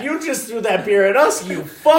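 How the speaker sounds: half-laughing, playing the furious friend; laughing mock anger. Teasing a friend by imitating him, laughing mock anger. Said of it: "You're like".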